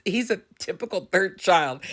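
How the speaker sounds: usual speaking voice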